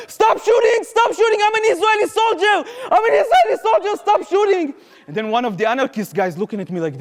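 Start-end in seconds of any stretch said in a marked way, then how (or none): 0.1-4.7 panicked scream. Retold or imitated. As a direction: scream it in terror, pitch way up. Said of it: "the only thing I can think about saying was just".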